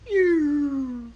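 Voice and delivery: high drawn-out ew; high ew sound. Retold or imitated. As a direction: squeal it high. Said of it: "at the last second just went".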